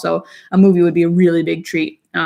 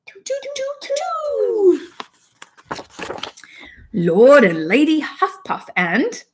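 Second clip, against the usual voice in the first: put-on slow, higher storybook British accent; 10 semitones higher, slower than usual. Mom reads a potty book to her daughter, British accent. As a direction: read it like a cheery British picture book.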